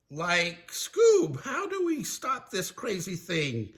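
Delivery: high-pitched cartoon panic; Shaggy hollering. Shaggy stuck in trouble with Scooby, high panicked holler. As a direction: holler high, then ease off in volume. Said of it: "Shaggy hollered".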